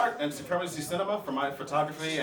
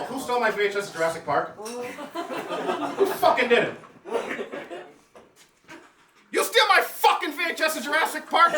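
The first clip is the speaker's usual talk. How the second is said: mock outrage; 7 semitones higher than usual. Mock angry rant.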